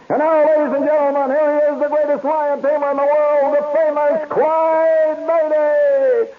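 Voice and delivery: high, loud, held-note announcement; circus ringmaster. Retold or imitated. Ringmaster introducing the lion tamer, grand high proclamation. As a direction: build to a long drawn-out name. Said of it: "And then he said this".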